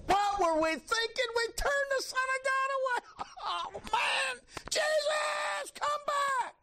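high, wailing cry after Jesus; high-pitched sobbing shout; anguished cry. Acted. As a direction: call out in despair as he walks away. Crowd regretting, crying out.

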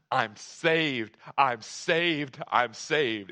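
loud, jubilant proclaiming; retold shout. Dad shouting through the house, loud and insistent. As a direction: shout it out, punching the word saved.

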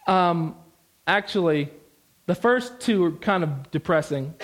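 ordinary talk, nothing marked

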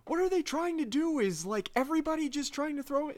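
high-pitched exasperated complaint; hammed-up agitated whine; mock freaking out. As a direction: imitate a man ranting in frustration. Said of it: "he's, like, freaking out".

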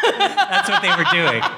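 giggling through the words; laughing. Real, not acted. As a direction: laugh while you say it. Laughing at the joke.

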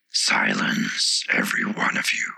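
low, whispered flower's shout for silence; hissed command. A narrator's reading. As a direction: silence them in a fierce whisper. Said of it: "cried the Tiger Lily".

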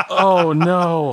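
laughing dismay. Acted. Vader dismayed.

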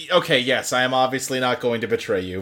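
mock fake sincerity. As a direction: promise it so hard it sounds like a lie. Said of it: "He says it in the most ... voice".

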